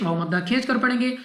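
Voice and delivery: normal talking voice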